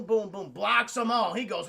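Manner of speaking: ordinary talk, nothing marked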